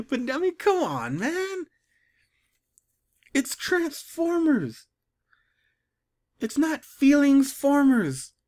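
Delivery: high-pitched, incredulous protest; exasperated whine. Real, not acted. Reader protesting to the writers about Transformers, exasperated whine. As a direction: stretch the words out in disbelief.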